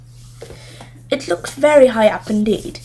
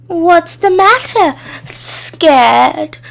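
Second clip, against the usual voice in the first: higher, louder taunt, slow and mocking; jeering; 7 semitones higher, 5 dB louder, much slower than usual. A narrator's reading. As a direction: taunt her for hesitating. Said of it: "jeered Sharkadder".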